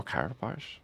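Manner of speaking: Carapace said softly as "CARE-a-posh"; doubtful alternative pronunciation. Shown as a demonstration. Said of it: "Like, now I'm confused".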